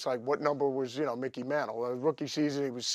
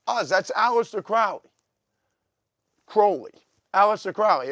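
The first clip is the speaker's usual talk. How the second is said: higher and louder, hammering the name; mock exasperated shout; 8 semitones higher, 10 dB louder than usual. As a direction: yell the answer at Oz. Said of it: "I go".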